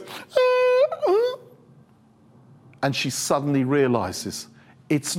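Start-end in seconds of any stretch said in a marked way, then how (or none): 0.0-1.4 very high comic squeal, then a short one; mock wail. Woman hearing her name called, hammed-up high cry. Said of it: "And she goes".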